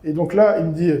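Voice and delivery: ordinary talk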